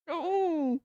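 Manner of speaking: ordinary delivery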